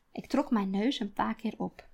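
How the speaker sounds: usual speaking voice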